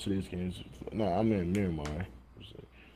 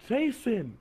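higher drawn-out call of "Jason" through a laugh; mom calling name; 11 semitones higher than usual. Retold or imitated. Retelling the mom yelling for Jason, laughing. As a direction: imitate the mom calling her son, laughing. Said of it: "his mom was like".

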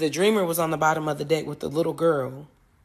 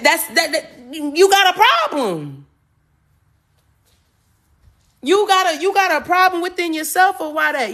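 high-pitched, exasperated insistence; indignant, raised pitch, hammering the point home; heated telling-off; 10 semitones higher, 4 dB louder than usual. Real, not acted. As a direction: push the voice high and stretch the accusation. Telling off someone projecting onto her.

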